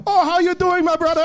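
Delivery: high-pitched imitation Indian accent; mimicked Indian accent. Dad imitating his kid mimicking an online friend, Indian accent. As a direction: put on a high, sing-song Indian accent. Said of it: "he was just an Indian kid".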